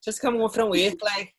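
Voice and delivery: chatty Scouse worker voice; Scouse accent. Retold or imitated. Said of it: "When you went to Liverpool".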